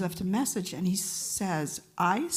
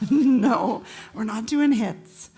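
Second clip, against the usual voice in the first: laughing no; 2 semitones higher, 9 dB louder, much slower than usual. Retold or imitated. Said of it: "And I said".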